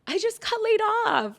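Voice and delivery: high, fast tearful blurting; teary whine. Retold or imitated. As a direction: blurt it out like a weepy little girl. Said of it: "And I'm like".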